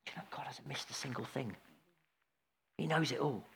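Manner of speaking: near-whispered, low and intimate; very soft, confiding preacher; hushed awe. Real, not acted. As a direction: drop right down to a hush.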